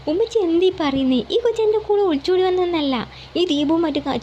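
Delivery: can't believe what she said; shocked denial. A narrator's reading. Son shocked at his mother's accusation, protesting.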